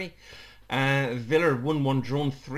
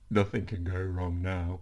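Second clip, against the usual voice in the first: low, slow mimicry of a friend; Ryan voice; 7 semitones lower, 3 dB quieter, slower than usual. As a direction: drop the voice and draw the words out. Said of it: "now do your Ryan voice".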